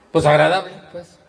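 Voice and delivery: high, loud, brusque retort; demonstrating a disagreeable tone; changed, unpleasant tone. Shown as a demonstration. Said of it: "¿Cambia?".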